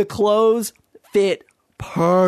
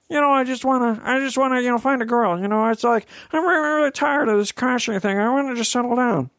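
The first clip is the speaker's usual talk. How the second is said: Owen Wilson impression; 6 semitones higher, much faster than usual. Owen Wilson voice pining for a girl to settle down. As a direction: sound like a dreamy, lovelorn Owen Wilson. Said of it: "That's my Owen Wilson impression".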